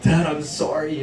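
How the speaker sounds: ordinary talk, nothing marked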